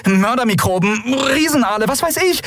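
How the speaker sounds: mounting high-pitched alarm; agitated. A narrator's reading. Captain listing what could kill Kai, increasingly agitated. Captain lists dangers in heated quarrel.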